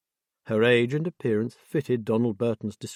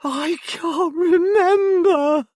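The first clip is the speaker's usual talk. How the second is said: screamed far higher and louder, slow and crying; ear-splitting scream; 9 dB louder, much slower than usual. A narrator's reading. Jessie screaming at Debbie in hysteria, ear-splitting scream. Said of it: "An ear-splitting scream tore".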